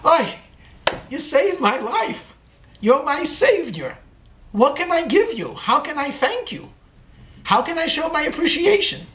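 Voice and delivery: high-pitched, gushing gratitude; enthusiastic. A narrator's reading. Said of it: "in her enthusiasm".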